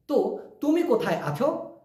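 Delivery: ordinary delivery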